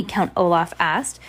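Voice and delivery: ordinary delivery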